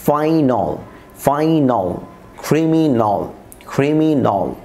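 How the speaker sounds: drawn "fai-nol", "krimi-nol" for "ফাইনাল", "ক্রিমিনাল"; corrected "-ol" ending. Shown as a demonstration. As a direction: round the last vowel of "ফাইনাল" and "ক্রিমিনাল" to o. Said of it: "আবার বলছি".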